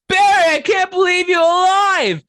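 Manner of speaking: high, squeaky shout of joy; overjoyed skeleton. Acted. Skeleton greeting his risen friend Barry, squeaky joyful shout. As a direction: cry out in shrill relief like a cartoon skeleton. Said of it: "And the other skeleton goes".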